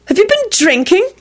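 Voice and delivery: high-pitched suspicious question. A narrator's reading. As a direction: question him sharply in a high voice. Secretary suspiciously questions her boss.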